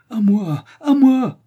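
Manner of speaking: high, loud desperate shout; calling for help. Acted. Riri calls through the broken window for help, shouting. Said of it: "se mit à appeler au secours".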